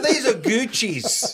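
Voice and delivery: high, slow, mock-indignant protest; offended. Owner defending his Gucci shoes to a stranger, offended. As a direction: drag it out, hammed-up and affronted. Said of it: "I've never seen him so offended".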